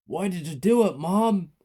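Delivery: high, loud anguished question; crying out. A narrator's reading. Child to the murderous mother, anguished. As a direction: cry out in grief. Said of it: "I cried".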